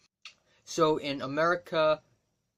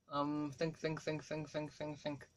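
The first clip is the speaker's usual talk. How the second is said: much softer, repeating think under his breath; muttering to himself; 11 dB quieter than usual. Real, not acted. Vlogger straining to recall an actress's name, softly muttering. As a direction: coax the memory out quietly.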